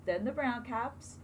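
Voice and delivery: plain reading voice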